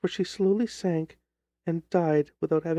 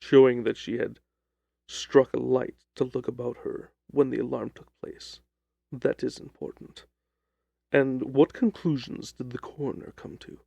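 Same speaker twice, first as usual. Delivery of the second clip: lower, measured detective voice; a calm, lower Holmes cutting in; 5 semitones lower than usual. Acted. Holmes reasons aloud.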